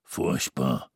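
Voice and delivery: Mister Xmas dismissing the sweater, low and flat-out; dismissive. A narrator's reading. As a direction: wave it off. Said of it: "winkte er ab".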